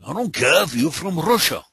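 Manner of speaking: high, hammed-up Russian accent; showing off a mock Russian accent. Shown as a demonstration. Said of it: "I can do a good Russian accent".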